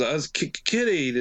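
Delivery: mock stammer, catching on the k; imitated stutter. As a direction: imitate a stutterer stuck before the word. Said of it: "and he's a stutterer too".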